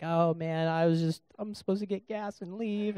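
soft, high tearful whimper; hammed-up weepy voice; mock crying. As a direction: act out tearfully protesting. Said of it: "And I'm, I'm crying".

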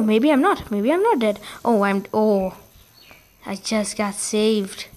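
usual speaking voice

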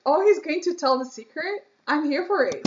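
high, loud and eager; thrilled, can't wait to hear; excited. Real, not acted.

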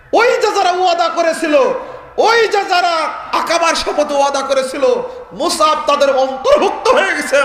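high, forceful sermon delivery; preaching at full force. Acted. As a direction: declaim it loudly to the whole crowd. (উঁচু গলায় জোরালো ওয়াজ)

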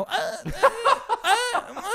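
very high, squeaky dithering; caught between yes and no. Acted. Host mocking undecided people, high-pitched hedging. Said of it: "people get caught between yes and no".

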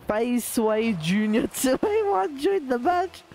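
slow, drawn-out name read with a laugh breaking in; mock announcer read. Streamer to chat, reading a joining player's name, amused and slow. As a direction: announce the silly gamertag slowly, amused. Streamer reads out a silly gamertag.

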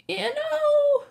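put-on high goat-boy voice; Grover voice. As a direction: pitch way up for the satyr.

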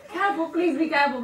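laughing. Real, not acted. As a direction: giggle through the warning. Laughing warning during challenge.

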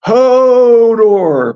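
long, high wailing moan; loud moan. A narrator's reading. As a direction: stretch the name into a loud wail. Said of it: "moaned Hodor".

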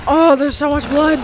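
loud, horrified cry about the blood; loud shock. Real, not acted. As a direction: react loudly, half-amused horror. Horrified at the gore.

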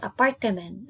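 APARTEMEN with four syllables, a-par-te-men; Bahasa pronunciation. Shown as a demonstration. Teacher showing the Bahasa word, Indonesian pronunciation. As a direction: say "apartemen" the Indonesian way, extra "te" inside. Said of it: "It's in Bahasa".

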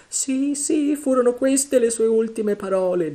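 high, wailing, fearful voice; terrified moan. A narrator's reading. Morgan recognising Flint's last words, moaning. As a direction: wail it in dread. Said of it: "gemette Morgan".

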